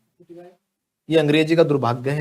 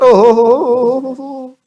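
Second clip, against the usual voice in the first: loud, slow hammed-up ow-ho-ho; loud mock yelp of scalding pain; mock burnt gasp; 7 semitones higher, 8 dB louder, much slower than usual. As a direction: pretend to burn your tongue on tea. Too hot tea, mock burn.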